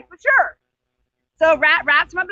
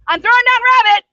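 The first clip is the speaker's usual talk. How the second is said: louder and higher, calling down from the tree; 6 semitones higher, 7 dB louder than usual. Acted. Turtle shouts down to Simba below the tree. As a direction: shout it down the trunk.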